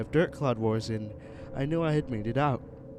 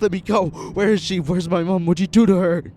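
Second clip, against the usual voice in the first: high, loud furious shouting; furious yelling; 6 semitones higher, 10 dB louder than usual. Acted. Boy held by a stranger yells furiously about his mom. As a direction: rage at the strangers holding you. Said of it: "but I was furious".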